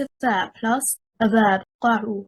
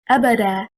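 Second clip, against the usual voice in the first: Abada said A-ba-da, first syllable stressed; 4 dB louder than usual. Shown as a demonstration. Teacher showing first-syllable stress on Abada. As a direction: hit the A of Abada. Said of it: "Stressing on the first harakah".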